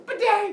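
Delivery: high, loud imitated twang; explosive string noise; angry strumming. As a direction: strike the strings in a temper. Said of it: "more and more angry".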